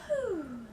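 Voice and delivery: a high, airy whew of exhaling breath; breathless whew. Real, not acted. Vlogger still catching her breath at the start, breathless whew. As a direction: blow out a relieved, out-of-breath whew. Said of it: "Sorry, I'm out of breath".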